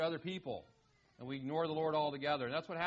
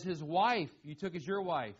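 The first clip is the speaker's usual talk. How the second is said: "His wife" louder and set apart, then "you took as your wife" plain; stress on his wife; 4 dB louder than usual. Shown as a demonstration. Preacher stressing the fronted words for the congregation, "His wife" louder. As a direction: hit "His wife" hard and pause before the rest. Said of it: "for emphasis".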